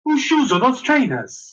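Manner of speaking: high, slow, confused Welsh; lilting, baffled Welsh question; Welsh accent. Shown as a demonstration. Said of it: "do a good Welsh accent".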